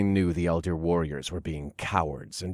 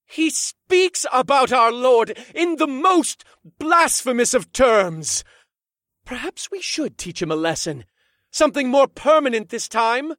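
menacing high voice; 7 dB louder than usual. Acted. Second Elder Warrior, high voice.

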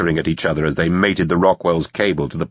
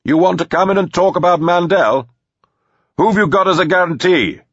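high, raised shouted challenge; shouting across; 11 semitones higher, 4 dB louder than usual. A narrator's reading. Teddy calling to the manor about Mandel, shouting. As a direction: yell the questions over a distance.

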